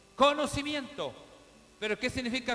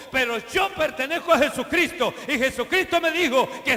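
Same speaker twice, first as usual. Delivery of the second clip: loud, rising pulpit exhortation; preaching at full force; 3 semitones higher, 6 dB louder than usual. Acted. Pastor rallying his congregation with a loud, fervent preaching voice. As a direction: preach it out to lift the whole church.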